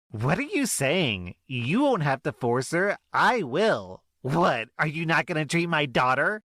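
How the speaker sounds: indignant mother. Acted. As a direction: challenge the nurse. Mother arguing with nurse.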